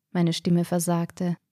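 ordinary delivery, nothing marked

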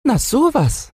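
very high piglet voice; tiny squeaky storybook pig; little pig. A narrator's reading. Little pig answers the wolf.

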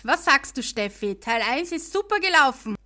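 usual speaking voice

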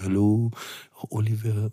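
put-on soft, lightly French-accented greeting; a hint of a French girlfriend's accent. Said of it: "diesem lieblichen französischen Akzent".

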